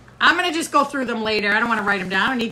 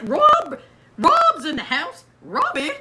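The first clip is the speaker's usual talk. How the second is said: excited greeting; 6 semitones higher, slower than usual. Real, not acted. Excited greeting of viewer Rob.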